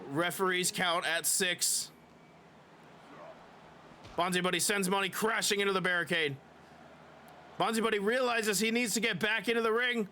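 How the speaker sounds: lively play-by-play announcer voice; wrestling commentator. Acted. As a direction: call the match like a wrestling commentator.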